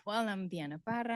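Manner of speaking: ordinary talk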